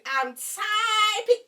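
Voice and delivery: high, loud, drawn-out word; exaggerated furious typing. Showing viewers her typing on the date, loud and drawn-out. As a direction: stretch it out at the top of your voice.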